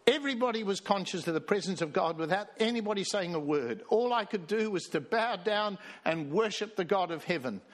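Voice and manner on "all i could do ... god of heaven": usual speaking voice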